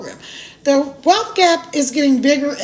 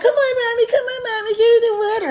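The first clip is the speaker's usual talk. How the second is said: put-on high, pleading kid voice, whiny; kids begging; 8 semitones higher, faster than usual. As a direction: imitate small kids whining at mom to come swim. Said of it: "So they're like".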